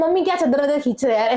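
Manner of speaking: annoyed snap. Retold or imitated. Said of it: "this time I got very angry".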